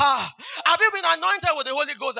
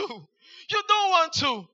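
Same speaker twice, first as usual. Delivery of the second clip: drawn-out warning; much slower than usual. Shown as a demonstration.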